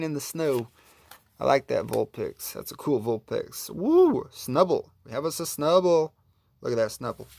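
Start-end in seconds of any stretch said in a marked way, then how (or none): 3.7-4.3 high, loud "woo"; excited whoop. Real, not acted. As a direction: whoop at a lucky find. Thrilled at a card pull.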